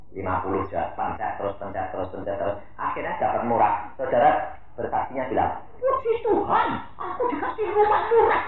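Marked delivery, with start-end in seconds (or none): 5.8-8.4 high, bright mocking quote of a smug buyer; squeaky put-on voice of a bargain hunter; mock happy testimony. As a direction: chirp it like a gloating churchgoer.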